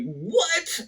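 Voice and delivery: very high-pitched incredulous "what"; retold disbelief. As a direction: squeal it like you can't believe the news. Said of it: "I'm just like".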